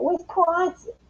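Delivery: Wisconsin drawn up high, mocking Wisconsin vowels; Wisconsin accent. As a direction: say Wisconsin the way a Wisconsin local would. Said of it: "that's how the New Yorkers talk".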